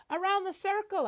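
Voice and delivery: high excited yell. Acted. Girl on the motorcycle yelling to her dad, excited high yell. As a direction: shout it out with joy. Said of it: "I yell".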